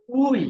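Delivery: উই with the উ stretched long, said high and loud; drawn-out vowel. Shown as a demonstration. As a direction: pull out the long উ in উই. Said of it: "একটু টেনে উচ্চারণ হবে". (উ টেনে লম্বা করে উই)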